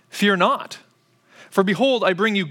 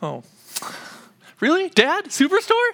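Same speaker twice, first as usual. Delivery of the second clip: much higher mock disappointment; let-down kids; 12 semitones higher than usual. Kids let down about Superstore, high mock dismay. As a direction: mimic kids deflated by the news. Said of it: "And they're like".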